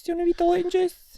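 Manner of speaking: squeaky Scottish offer; mock Scottish host, pitched very high; high-pitched Scottish accent. Offering friend a drink, high-pitched Scottish accent. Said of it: "doing that in a high-pitched voice".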